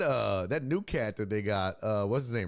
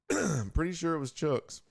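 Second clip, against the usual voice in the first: usual speaking voice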